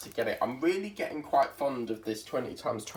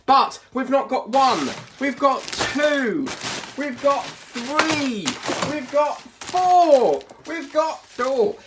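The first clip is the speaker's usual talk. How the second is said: teasing build-up; 12 semitones higher, 5 dB louder, much slower than usual. Acted. Counting up her hauls, teasing.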